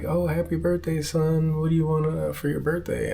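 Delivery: sweet, high imitation of mom asking; voicing mom. Mom greeting son on birthday.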